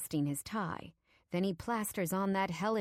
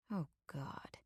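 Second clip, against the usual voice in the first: very soft, faint gasp of horror; hushed dread; 2 semitones lower, 9 dB quieter than usual. A narrator's reading. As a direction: let it drop away in dread. Narrator nearly faints in dread.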